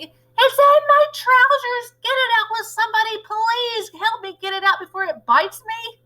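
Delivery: high, loud frantic pleading; shouting. Acted. Stupid cook begging the staff for help, high frantic shout. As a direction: beg them to get it out. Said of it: "the stupid cook still shouting".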